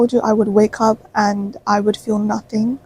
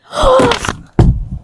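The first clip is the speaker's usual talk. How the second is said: high, dramatic gasp; a loud theatrical gasp; sharp gasp; 4 dB louder than usual. Acted. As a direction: gasp as if shocked. Sudden reaction at friend Fifi.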